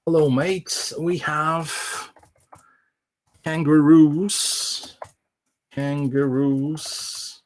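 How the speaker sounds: drawn-out, word-by-word dictation; slow dictation. Shown as a demonstration. Presenter dictating a test line to the translator, slowly and deliberately. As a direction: spell it out slowly for the machine to hear. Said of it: "and I'm gonna try".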